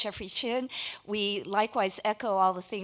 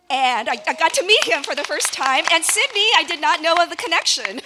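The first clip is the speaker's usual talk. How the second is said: excited; 7 semitones higher, 10 dB louder than usual. Real, not acted. Excited surprise at connection.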